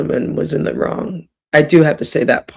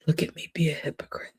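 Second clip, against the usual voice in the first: whisper; 12 dB quieter, much faster than usual. Real, not acted. Confessing to viewers she's a hypocrite, whispered. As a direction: repeat it in a fast breathy whisper. Repeats the line as a hushed aside.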